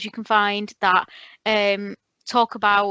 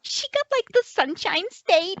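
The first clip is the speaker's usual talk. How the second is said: high-pitched American gushing; mock American perkiness; fake-positive American accent; 10 semitones higher than usual. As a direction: put on a perky American voice. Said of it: "I always do an American accent".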